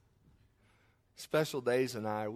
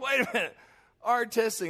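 hasty correction; 8 semitones higher, 5 dB louder than usual. Retold or imitated. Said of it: "I'm like".

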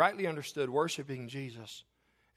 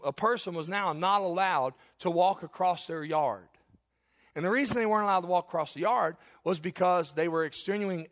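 louder, higher preaching, gathering speed; heated preaching; 3 semitones higher, 3 dB louder than usual. Acted. Preacher scorns the Pharisees' added laws, heated preaching. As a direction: build the case against the Pharisees.

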